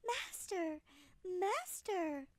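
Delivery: high alarmed cry; a magic harp calling for help; harp screaming. Acted. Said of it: "when it screamed".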